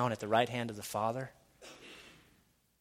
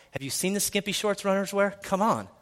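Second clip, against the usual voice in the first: ordinary talk, nothing marked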